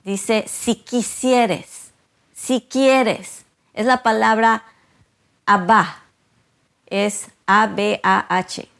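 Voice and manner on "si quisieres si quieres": "quisieres" drawn out very slowly, then "quieres" lifted higher; emphasised word. Shown as a demonstration. Preacher stresses the key word for the congregation, very slow. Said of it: "quiero enfatizar la palabra aquí".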